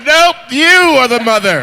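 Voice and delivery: high, loud mock declaration; acted quote. Host re-enacting mistaking the mother, hammed-up and loud. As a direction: deliver a hammed-up verdict. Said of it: "She was like".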